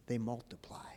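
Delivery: hushed whisper for effect. Acted. Preacher whispering to the congregation, hushed. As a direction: drop to a whisper to make them lean in.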